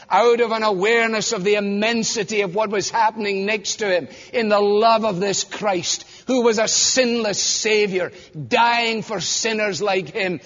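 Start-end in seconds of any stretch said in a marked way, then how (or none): none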